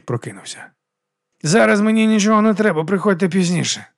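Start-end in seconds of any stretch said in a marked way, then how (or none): 1.4-3.8 raised, higher call; annoyed shout. A narrator's reading. Man shouting into his pillow at intruders, raised and higher. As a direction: call out to send the visitor away, easing off at the end. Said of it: "крикнув Гаррі в подушку".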